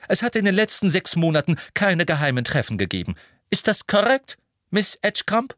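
high, brisk, firm voice; brisk McGonagall. Acted. McGonagall sums up Marietta's answer, brisk and firm. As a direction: rattle it off, then question pointedly.

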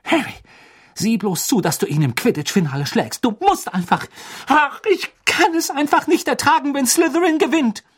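high, agitated plea building to a loud outburst; aufgebracht. A narrator's reading. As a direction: urge him on, beside yourself. Hermine still worked up, urging Harry. (aufgeregt, immer höher und lauter)

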